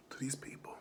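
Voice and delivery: dropped to a whisper. Acted. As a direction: hush the last words. Whispered ending in promo.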